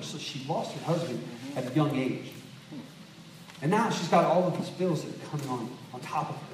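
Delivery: low, soft, sincere sermon voice; hushed earnest preaching. Acted. Preacher telling the congregation of the widow's grief, hushed and sincere. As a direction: draw the congregation in quietly.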